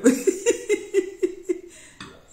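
shrieking high laughter, very loud; real laugh, high and loud; loud high cackle. Real, not acted. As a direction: let out a piercing cackle. Keeps laughing.

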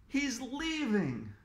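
high, slow, relieved; slow relieved girl. A narrator's reading. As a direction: watch it go. Emily relieved.

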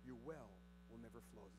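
suddenly soft and low; hushed. Acted. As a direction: drop to a near murmur for weight. Sudden drop after loud line.

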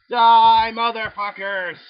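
very loud, high, drawn-out yell; battle cry. Real, not acted. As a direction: scream it as you open fire. Blasting zombies.

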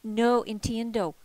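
loud, pleading outburst; desperate loud plea; pleading cry. Retold or imitated. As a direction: throw the words out, begging to understand. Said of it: "in a pleading gesture and cry".

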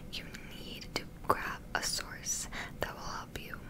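ordinary talk

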